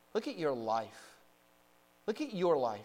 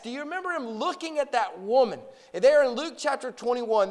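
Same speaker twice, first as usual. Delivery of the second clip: raised, higher-pitched preacher's voice; forceful preaching; 9 semitones higher, 9 dB louder than usual. Real, not acted. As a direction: preach it out to the whole congregation.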